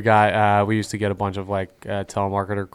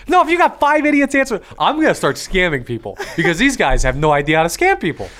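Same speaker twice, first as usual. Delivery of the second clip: laughing; 11 semitones higher, 4 dB louder than usual. Real, not acted. Mocking scammers, high and laughing.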